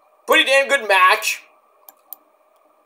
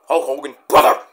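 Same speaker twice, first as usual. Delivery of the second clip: Hulk Hogan impression; 5 semitones lower than usual. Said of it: "That's easy".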